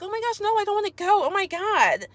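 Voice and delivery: mocking high-pitched whine; hammed-up helpless dismay; Alicia. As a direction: play up a distressed, pleading protest far above your own pitch. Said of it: "make fun of Alicia".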